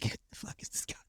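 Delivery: hushed, breathless inner thought; whispered aside. Retold or imitated. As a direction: whisper it to yourself in disbelief. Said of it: "I'm like".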